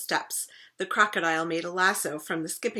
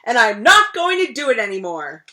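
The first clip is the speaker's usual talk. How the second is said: yelled; 4 semitones higher, 12 dB louder than usual. Acted. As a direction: let the temper burst out. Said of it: "he yelled".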